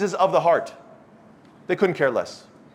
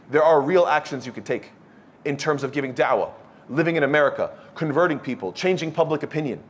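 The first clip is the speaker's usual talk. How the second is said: ordinary talk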